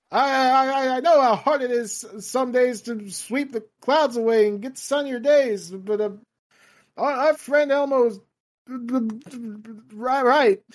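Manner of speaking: lightly voiced, faltering old man; a hint of a halting elderly president; Biden impression. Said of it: "this is a fake old man voice".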